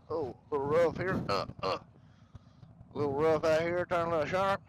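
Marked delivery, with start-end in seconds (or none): none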